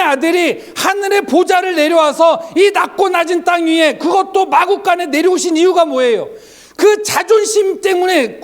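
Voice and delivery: high-pitched, forceful sermon delivery; impassioned preaching. Acted. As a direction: drive the point home to the congregation. (높은 목소리로 힘 있게 외치는 설교)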